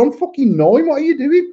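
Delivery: high-pitched comic outrage; indignant child's protest. Acted. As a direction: play an outraged kid, pitch shooting up. Acting nine-year-old protesting to dad.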